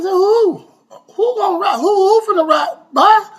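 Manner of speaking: high, loud, mocking squeal of "who"; scoffing disbelief. To Slim, who offered to ride against everyone, mocking high squeal. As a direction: ridicule the offer in a piercing high voice.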